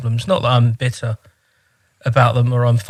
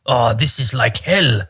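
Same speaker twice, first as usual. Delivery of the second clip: imitating coworker; slower than usual. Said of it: "everything was like hell".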